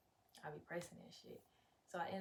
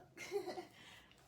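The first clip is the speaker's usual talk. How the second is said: startled laugh; 11 semitones higher than usual. Real, not acted. Laughs after startled curse.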